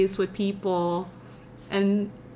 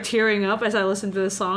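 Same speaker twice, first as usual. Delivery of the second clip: ordinary talk, nothing marked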